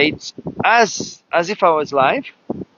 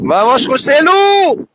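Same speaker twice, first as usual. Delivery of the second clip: cheering; 7 semitones higher, 3 dB louder than usual. Real, not acted. Cheering a racer on.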